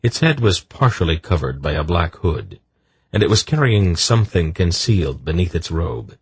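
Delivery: plain reading voice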